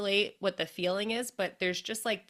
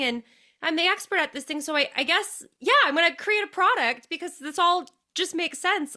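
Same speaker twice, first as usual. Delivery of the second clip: much higher and louder, a carefree can-do voice; breezy mock Emily; 9 semitones higher, 5 dB louder than usual. Host imitating her guest's easygoing logic, much higher. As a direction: play her as shrugging it off, cheerfully casual.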